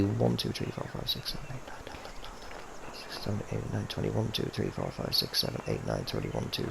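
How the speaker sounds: quiet whispered count under breath. Real, not acted. Whispered counting of putt slope.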